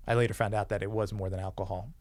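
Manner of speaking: ordinary talk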